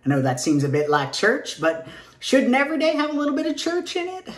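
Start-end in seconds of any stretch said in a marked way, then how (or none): 1.6-4.3 high, fast Southern twang; nervous Southern-accented chirp; Southern twang slipping. Acted. Nervous twangy pitch jump.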